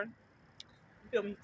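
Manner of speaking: high wheezy laugh breaking through. Real, not acted. Vlogger cracking up at her own birthday story, laughing. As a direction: laugh it out at your own joke.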